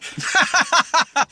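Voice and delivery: high laugh in a different voice; laughing after the impression. Real, not acted.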